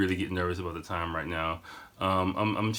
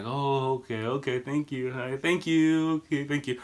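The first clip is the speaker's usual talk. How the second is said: higher, sing-out insistent thanks; polite brush-off; 5 semitones higher than usual. Shown as a demonstration. Waving off an overexcited stranger, polite brush-off. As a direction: thank them repeatedly while meaning back off. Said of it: "back up".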